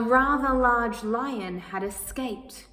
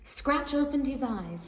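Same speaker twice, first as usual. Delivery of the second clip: ordinary delivery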